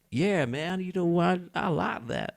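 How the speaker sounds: put-on Texan accent. Retelling the night he kept a fake accent, in a mock Texan drawl. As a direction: ham up a Texan cowboy. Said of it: "that was more Texan".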